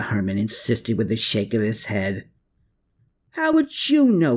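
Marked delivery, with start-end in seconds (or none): 3.3-4.4 high, loud angry holler. A narrator's reading. Klaus hollers at Herman in a high, loud rage. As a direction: hurl the question at him full voice. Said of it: "Klaus hollered".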